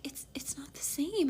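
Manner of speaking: breathy hurt whisper. Retold or imitated.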